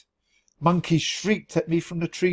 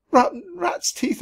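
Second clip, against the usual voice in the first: much higher, squeaky wail; tearful squeak. A narrator's reading. Lost mouse squeaking to himself in despair. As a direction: squeak it out near tears. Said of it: "I squeaked".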